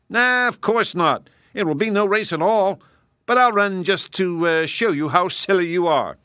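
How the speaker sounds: boastful hare. Acted. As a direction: brag as the vain hare.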